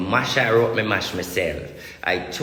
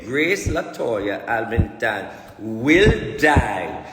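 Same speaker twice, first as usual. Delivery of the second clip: each word laid down slowly and heavily; slow, weighty "Grace Latoya Alvington will die"; slow solemn repeat; much slower than usual. Real, not acted. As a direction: spell out the prophecy slowly. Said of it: "I tell you again".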